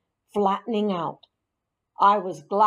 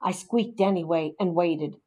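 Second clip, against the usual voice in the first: ordinary delivery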